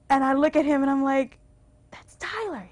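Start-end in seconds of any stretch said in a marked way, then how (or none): 1.9-2.7 hushed. Retold or imitated. Whispering in theater.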